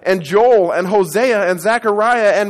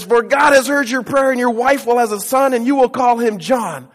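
higher, louder angel's news; bright, raised announcing voice; joyful announcement; 5 semitones higher, 4 dB louder than usual. Retold or imitated. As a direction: proclaim glad news.

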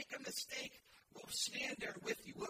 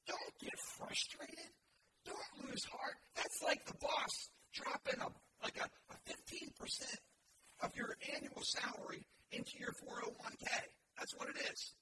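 ordinary talk

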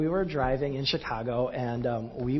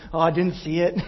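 laughing; 4 semitones higher, 5 dB louder than usual. Retold or imitated. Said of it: "I was like".

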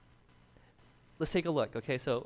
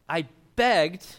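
"I begged" pushed much louder on begged; stress on begged; 2 semitones higher, 9 dB louder than usual. Shown as a demonstration. Pastor stressing the father's word begged. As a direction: hit "begged" hard and loud. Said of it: "The word begged in the Greek".

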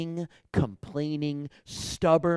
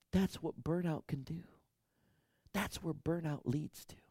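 softer, breathy aside; whispered; 4 semitones higher, 6 dB quieter than usual. Real, not acted. Preacher driving home burnout, whispered. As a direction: drop to a whisper for weight.

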